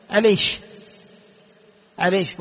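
"عليش", ending in sh in place of kaf; kashkasha pronunciation. Shown as a demonstration. Teacher showing students the kashkasha dialect, "عليش" with sh replacing k. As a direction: say "عليش" with a final sh for k. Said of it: "إبدال الكاف شين".

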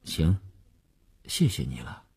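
low, soft, downcast thanks; subdued and sullen, trailing down; glumly. A narrator's reading. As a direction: mumble thanks with no joy. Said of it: "他闷闷地说".

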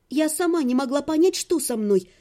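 usual speaking voice

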